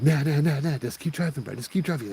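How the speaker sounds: high, urgent protest; alarmed pleading. Acted. Passenger begging driver to keep driving past the lady, alarmed. Alarmed friend refuses to stop.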